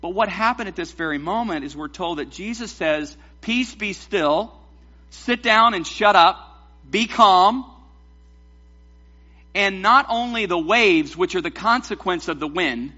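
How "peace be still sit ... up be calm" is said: raised commands building louder; yelled rebuke. Acted. Jesus silences the waves and wind, yelled rebuke. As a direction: order it firmly, building to a shout. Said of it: "He yells at a cold front".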